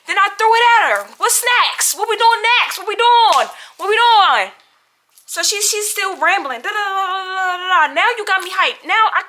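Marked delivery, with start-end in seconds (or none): 1.2-4.5 high, loud taunting challenge. Retold or imitated. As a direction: goad her, hyped up. Daring roommate after throwing food.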